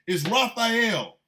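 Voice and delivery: loud, high, drawn-out announcement; slow confrontational shout; acted challenge. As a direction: announce yourself slowly and loudly for a showdown. Acts announcing himself to Trump.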